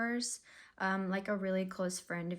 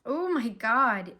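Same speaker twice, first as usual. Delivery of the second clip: slow louder oh my God; surprised; 7 dB louder, slower than usual. Real, not acted. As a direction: let the card shock you. Reaction to a card draw.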